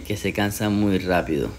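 usual speaking voice

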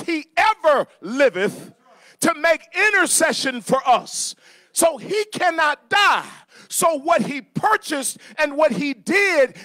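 loud, high, drawn-out preacher's shout; full-force preaching. Real, not acted. Preacher declaring Christ's eternal intercession to the church, shouting triumphantly. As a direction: proclaim it at full voice, leaning on the big words.

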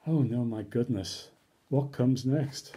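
ordinary delivery, nothing marked

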